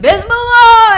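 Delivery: high, loud exclamation; loud call. Retold or imitated. Mom answering a call to show she is praying, loud. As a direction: announce it to the whole house. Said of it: "she'd be like".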